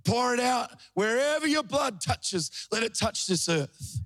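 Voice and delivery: high, ringing prayer calling over the nations; fervent prayer. Retold or imitated. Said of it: "I said".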